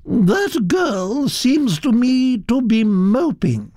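high, slow, disapproving Duchess; haughty and drawn out, pitched high; imperious Duchess. Acted. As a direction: pass judgement as a grand society lady.

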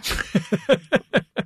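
light run of real chuckles; short, easy laugh; amused chuckle. Real, not acted. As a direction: chuckle along at the phrase. Laughs after creative complaining.

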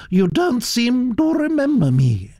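put-on high, gossipy old aristocrat voice, pitched well up; elderly Duchess. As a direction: chatter on like a grand old lady.

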